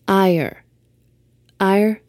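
Ire pronounced like "eye-er", as in rage; clear Ire. Shown as a demonstration. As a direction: spell out Ire as rage, not iron. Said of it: "not iron".

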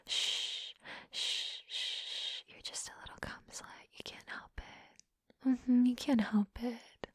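soft mocking whisper. Whispering taunts to the listener, hushed. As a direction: breathe the taunt close to the ear.

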